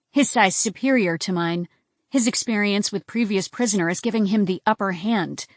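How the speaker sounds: ordinary delivery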